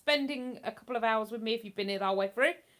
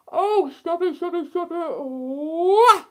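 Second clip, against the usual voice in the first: loud, higher words swallowed by a yawn; real yawning; 7 semitones higher, 10 dB louder, slower than usual. Real, not acted. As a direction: talk through a big rising yawn. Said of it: "I got the yawns".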